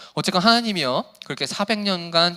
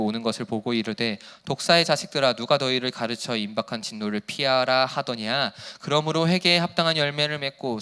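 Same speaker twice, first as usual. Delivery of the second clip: steady, measured Bible reading voice; scripture reading; 8 semitones lower, 4 dB quieter than usual. Acted. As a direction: read the passage solemnly, like a pastor. Reads scripture aloud. (차분하고 경건한 성경 낭독)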